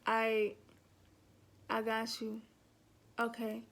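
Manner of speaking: low, casual phone replies; playing it chill. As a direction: imitate a guy keeping it cool on the phone. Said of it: "trying to be all chill on the phone".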